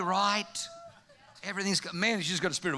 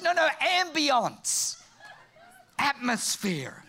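AMBIENCE and ATMOSPHERE stretched out slowly; slow, drawn-out stress on "ambience"; corrective emphasis; 3 semitones higher, much slower than usual. Acted. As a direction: spell out "ambience" slowly for someone who misheard. Wife correcting husband's misheard word.

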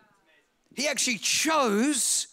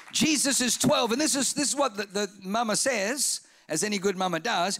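usual speaking voice

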